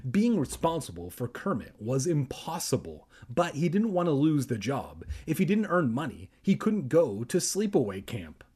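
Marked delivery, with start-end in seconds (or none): none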